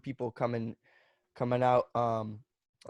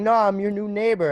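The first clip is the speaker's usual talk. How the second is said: imitating neighbor; 9 semitones higher, 9 dB louder than usual. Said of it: "he was like".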